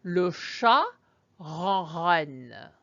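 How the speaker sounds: ronronne rolled at the back like purring; le chat ronronne with purring R sounds; purring French R. Shown as a demonstration. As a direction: purr the R's in ronronne. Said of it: "try it after me".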